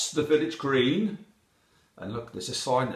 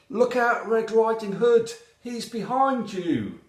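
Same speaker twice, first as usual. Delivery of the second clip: high, loud cry of alarm; warning shout; 12 semitones higher, 6 dB louder than usual. A narrator's reading. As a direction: shout a warning as the little rabbit. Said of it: "Then a little rabbit shouts out".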